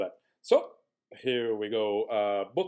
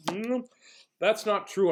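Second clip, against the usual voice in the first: plain reading voice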